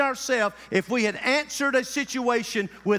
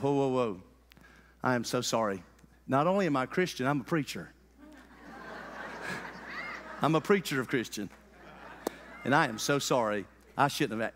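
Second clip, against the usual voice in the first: much lower and softer, contrite; humble apology; 10 semitones lower, 6 dB quieter, faster than usual. Retold or imitated. Preacher retelling apologizing to the cleaner, soft and contrite. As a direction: back down gently, meek and sorry.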